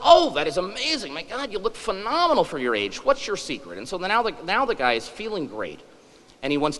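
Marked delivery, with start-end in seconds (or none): 0.0-3.8 mock-amazed clerk, pitch jumping up; a clerk lightly voiced, amazed. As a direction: gush over how young he looks. Clerk amazed at his looks.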